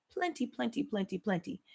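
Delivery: dwindling plenty, plenty; fading repeat. Acted. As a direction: let the repeats fade into a murmur.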